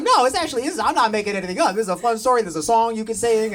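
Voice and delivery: high, rushed and insistent; talking fast to prove it's real; defensive. Retold or imitated. Comic defends Rudolph to his girlfriend, rushed and insistent. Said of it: "double down and get all defensive".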